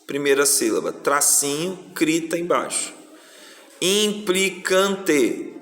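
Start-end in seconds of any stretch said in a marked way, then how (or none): 3.8-5.5 "Implicante" very slowly, syllable by syllable. Shown as a demonstration. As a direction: stretch every syllable for pupils. Teacher dictates word syllable by syllable. (sílaba por sílaba, bem devagar)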